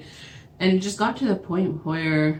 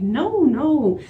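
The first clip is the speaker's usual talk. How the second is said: high, emphatic exasperated "no"; raised-pitch insistent refusal; retold refusal; 8 semitones higher, 4 dB louder than usual. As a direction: re-enact shutting him down. Firm refusal of his pleading.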